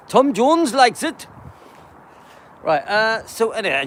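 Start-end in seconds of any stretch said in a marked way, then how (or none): 0.1-1.3 high, loud, slow mock-Welsh; silly Welsh accent. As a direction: say it in a playfully exaggerated Welsh accent. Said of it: "just being silly".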